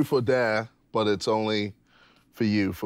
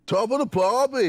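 higher-pitched mock Australian accent; attempted Australian accent; 10 semitones higher than usual. Shown as a demonstration. Contestant on a dare from his date, hammed-up Australian accent. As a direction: put on your best Australian accent. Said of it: "could you do your best Australian accent".

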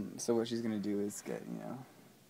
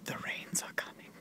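whisper; 7 dB quieter than usual. Pretend. Ominous aside.

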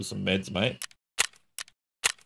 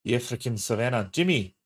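mock South African accent, louder; hammy South African greeting; 3 semitones higher, 5 dB louder than usual. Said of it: "Your, your African accent".

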